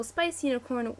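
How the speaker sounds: normal talking voice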